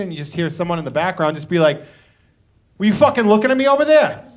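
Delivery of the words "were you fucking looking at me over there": higher, mock-aggressive challenge; hammed-up confrontational Bostonian; acted belligerence. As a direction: play a club tough picking a fight. Mimicking belligerent Boston club-goer.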